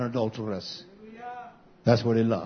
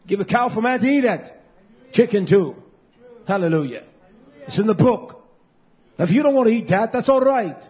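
rising, louder and higher, hammering each line; full-force preaching; 7 semitones higher, 5 dB louder than usual. Acted. Pastor rebuking the woman in full preaching voice.

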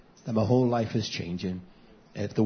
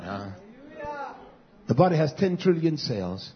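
ordinary talk, nothing marked

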